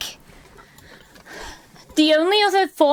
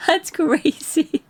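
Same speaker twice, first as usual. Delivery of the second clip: usual speaking voice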